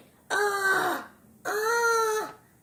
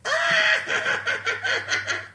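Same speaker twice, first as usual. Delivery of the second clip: ticklish giggle; 9 semitones higher than usual. Pretend. Being tickled by host.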